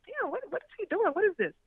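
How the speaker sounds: high, puzzled "what is he doing"; confused surprise. Retold or imitated. Left in the car, she wonders aloud in high confusion. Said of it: "I'm like".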